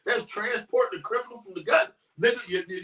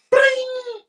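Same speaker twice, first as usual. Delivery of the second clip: phone ring sound; 7 dB louder than usual. Pretend. Phone ringing sound effect.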